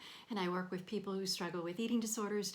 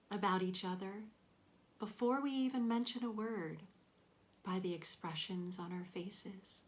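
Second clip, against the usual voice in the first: guided meditation. Real, not acted. Counselor leading listeners through a body scan, calm guided meditation. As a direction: guide them gently through breath and body, leaving space between phrases.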